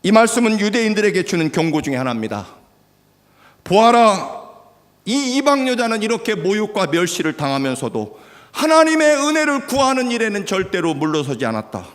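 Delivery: loud, high, driving sermon voice; fiery preaching. Real, not acted. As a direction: preach it hard to the crowd. Preaching at full force.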